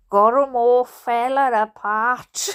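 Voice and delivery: put-on rough Hampshire accent for Steve. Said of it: "try out other accents in this book".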